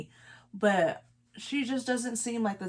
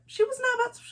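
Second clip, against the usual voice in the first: way higher squeaky voice, mocking; mock squeal; much faster than usual. Mocking Alexis refusing.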